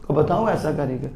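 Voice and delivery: soft awed echo of the challenge; hushed echo. Real, not acted. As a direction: drop to a hush on the repeat. Challenge repeated loud then hushed.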